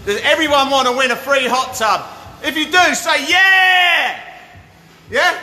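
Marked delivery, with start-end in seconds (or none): 2.4-5.4 high, loud rallying call; hyped shout. Real, not acted. Live host hyping his viewers, loud shout. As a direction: whip the viewers into a cheer.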